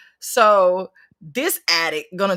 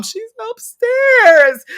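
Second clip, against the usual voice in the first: slow high answer; 12 semitones higher, 6 dB louder, much slower than usual. Retold or imitated. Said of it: "Where's mom?".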